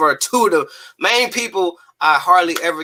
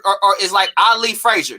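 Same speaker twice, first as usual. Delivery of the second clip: usual speaking voice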